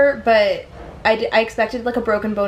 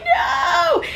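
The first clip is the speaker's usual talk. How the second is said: panicked yell. Retold or imitated. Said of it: "I'm like".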